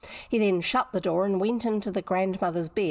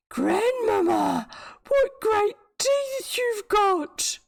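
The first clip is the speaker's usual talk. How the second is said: put-on higher, slow wide-eyed little girl; 13 semitones higher, 4 dB louder, much slower than usual. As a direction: sound small and amazed. Girl's voice, higher and louder.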